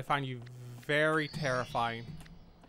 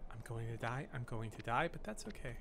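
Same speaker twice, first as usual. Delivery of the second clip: quiet; 6 semitones lower, 12 dB quieter than usual. Real, not acted. Resigned while hiding.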